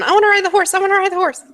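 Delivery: put-on high, loud, childlike begging; excited high-pitched pleading; excited jumping up and down. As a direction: pipe it up eagerly, bouncing on the spot. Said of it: "I start jumping up and down".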